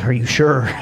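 lightly voiced foreman, unsure and asking; doubtful foreman. Foreman asks the electrician about the wire, worried. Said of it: "looks over at him and says".

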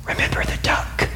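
soft threatening whisper; a sly sister's whispered warning; menacing whisper. Acted.

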